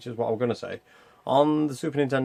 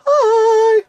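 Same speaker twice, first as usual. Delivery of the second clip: very high, louder, put-on squeak; squeaky mock hi; 9 dB louder than usual. Gamer greeting the viewers in a squeaky put-on voice. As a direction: pop in with a squeaky cartoon hello.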